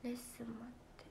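soft unvoiced whisper. Real, not acted. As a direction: breathe it out without voice, confiding. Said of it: "whispering announcements".

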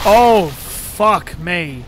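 loud, drawn-out cry of dismay; real alarm, slow and loud; alarmed swearing. Real, not acted. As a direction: curse loudly as trouble appears. Monster appears in game fight.

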